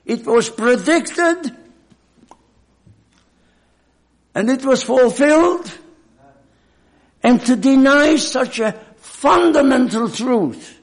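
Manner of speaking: building to loud, high preaching; fervent preaching. Real, not acted. Preacher warns the brethren, rising to fervent loud preaching. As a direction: press the congregation with rising fire. Sermon rising to full force.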